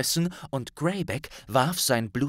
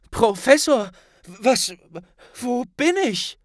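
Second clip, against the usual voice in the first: high, loud confused questions; boy startled to see his professor; bewildered Harry; 11 semitones higher, 5 dB louder than usual. A narrator's reading. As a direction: ask as if just waking up somewhere strange.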